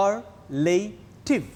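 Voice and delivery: "superlative" slowly as su... per... la... tive; "superlative" broken into su-per-la-tive for counting; split into syllables. Shown as a demonstration. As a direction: sound out "superlative" in four separate beats. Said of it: "four syllables".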